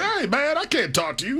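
high, bright-pitched hey man, then settling; Magic Johnson impression. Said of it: "is that your Magic Johnson impersonation?".

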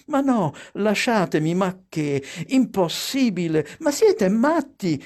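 high, flustered objections climbing at the end; flustered protesting. A narrator's reading. Mother fending off her excited children, flustered protest. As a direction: fend them off, pitch leaping on the last phrase. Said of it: "cercava di schernirsi, ripetendo".

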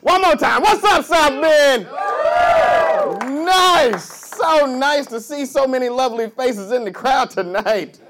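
loud, high, hyped-up hello to the crowd; shouted crowd greeting. Real, not acted. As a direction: open the show at full shout, stretching words. Comic greets the crowd on stage.

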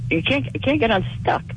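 usual speaking voice

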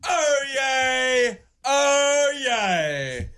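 long, drawn-out high cries with falling ends; town crier proclamation. Shown as a demonstration. New town crier announcing himself, proclaiming grandly. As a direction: proclaim it slowly, grandly, rolling the name.

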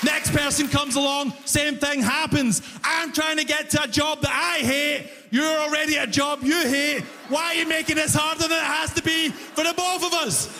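high-pitched, pleading exasperation; exasperated rant. Retold or imitated. Commuter protests to the clerk making things harder, exasperated. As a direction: argue your case high and insistent.

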